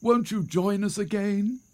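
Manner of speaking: high-pitched imploring question; begging. A narrator's reading. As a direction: beg him to come back. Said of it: "they begged".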